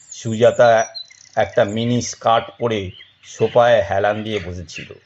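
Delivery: ordinary delivery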